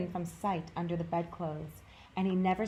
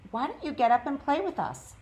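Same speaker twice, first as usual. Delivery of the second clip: curious wild rabbit; 8 semitones higher, 5 dB louder than usual. A narrator's reading. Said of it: "one of them asked".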